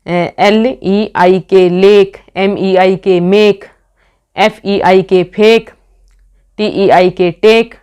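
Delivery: slow spelling out. Shown as a demonstration. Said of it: "ये हमारा".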